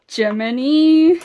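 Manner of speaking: loud, high, stretched-out name; delighted cry. Real, not acted. Fan greets a [name] card, delighted drawn-out shout. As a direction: sing out the name long and loud in joy.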